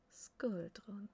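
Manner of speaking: ordinary delivery, nothing marked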